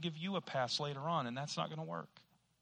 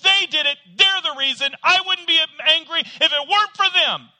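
shouting, high, blaming them; ranting at "them, them, them"; angry blamer; 10 semitones higher, 15 dB louder than usual. Retold or imitated. Preacher acting an angry person blaming others, loud shouting. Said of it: "Somebody gets angry".

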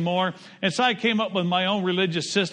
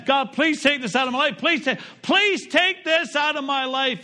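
higher, urgent repeated begging; mildly raised imploring plea; pleading prayer; 7 semitones higher than usual. Retold or imitated. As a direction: plead again, more insistent. Paul pleading three times.

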